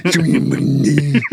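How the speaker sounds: "Show me them fucking titties" as a low wordless mumble; words barely pronounced. Retold or imitated. Husband's well-worn line to wife, "Show me them fucking titties" barely pronounced. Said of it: "I don't have to pronounce any of the words".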